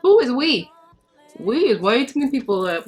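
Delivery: loud, high incredulous retort; indignant retort. Retold or imitated. Woman to man who invited himself to her birthday, indignant retort. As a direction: push back loudly, acting offended.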